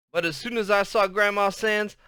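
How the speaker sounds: plain reading voice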